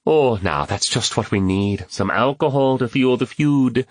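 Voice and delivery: ordinary delivery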